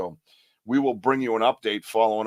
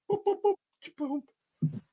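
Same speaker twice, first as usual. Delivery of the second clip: tiny high, soft boops; slow string of high little boop noises; imitated boops; 6 dB quieter, slower than usual. Host making idle noises to viewers, high soft boops. Imitated beeps while filling time.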